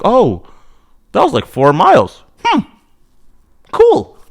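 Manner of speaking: pleased surprise, rising high; Cool said high and bright with surprise; surprised. Retold or imitated. Surprised at distance run.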